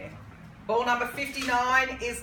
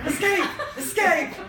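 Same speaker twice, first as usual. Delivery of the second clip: laughing; 7 semitones higher, 5 dB louder than usual. Real, not acted. Jokes about escaping, laughing.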